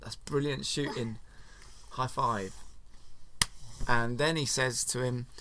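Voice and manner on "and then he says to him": usual speaking voice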